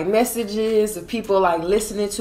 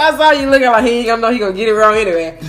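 fast, loud laughing teasing; laughing tease; 4 semitones higher, 8 dB louder, faster than usual. Real, not acted. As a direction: laugh while you tease.

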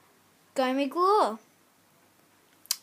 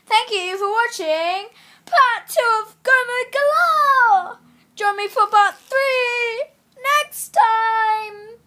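higher, louder, enthusiastic sign-off; bright vlog outro; 8 semitones higher, 10 dB louder than usual. Acted. Kid ending the video for viewers, excited outro. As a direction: sign off to your viewers with big energy.

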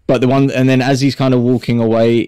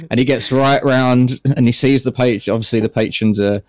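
normal talking voice

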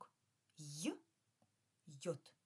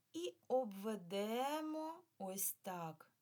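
1 slow demo; 5 semitones higher, 5 dB louder, much slower than usual. Shown as a demonstration. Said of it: "shows drawing slowly".

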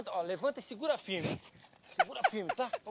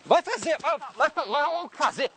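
louder, higher-pitched dismissal; heated argument; 3 semitones higher, 6 dB louder, much slower than usual. Acted. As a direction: send them off angrily, louder. Heated quarrel in a prank.

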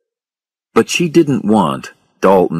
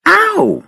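high, startled "ow"; pained ow; 3 dB louder than usual. A narrator's reading. Woman puts her foot in hot water, pained yelp. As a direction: let out a high yelp of pain.

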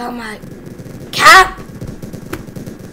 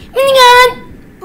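high, slow excited shout of "Minigun!"; 9 semitones higher, slower than usual. Real, not acted. Gamer gleefully picks the minigun. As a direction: blurt it out with delight.

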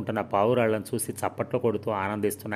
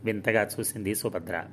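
plain reading voice

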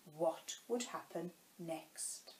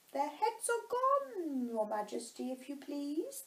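mock shouted reply; 7 semitones higher than usual. A narrator's reading. Soldier reports back to the Queen, lightly called out. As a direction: call back in a high, restrained voice. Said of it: "the soldier shouted in reply".

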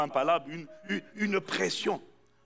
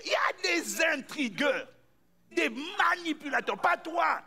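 fiery sermon delivery; preaching; 7 semitones higher than usual. Acted. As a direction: preach it out to the congregation. Preaching at full force.

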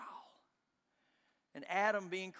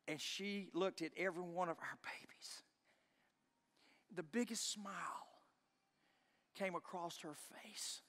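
whispered, much softer; hushed tender recall; 6 dB quieter than usual. Real, not acted. Husband remembering his wife's smile after birth, hushed whisper. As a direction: hush as you picture her smile.

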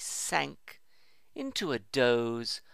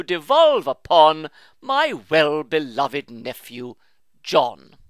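solemn will reading; 4 semitones higher, 11 dB louder than usual. A narrator's reading. Rich uncle pompously reading out his own will. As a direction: read it out like a grand solemn testament.

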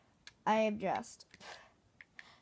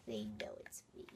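whispering; 14 semitones lower, 10 dB quieter, much faster than usual. Real, not acted. Whispered aside.

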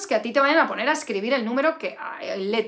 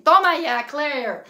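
triumphant; 3 semitones higher, 7 dB louder, slower than usual. Real, not acted. Cheers own answer.

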